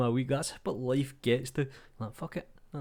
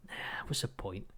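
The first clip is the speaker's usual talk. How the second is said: slow whispered throwaway; whispered aside; 3 semitones lower, 9 dB quieter than usual. Real, not acted. Vlogger dismissing his own idea, whispered aside. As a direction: shrug it off under your breath.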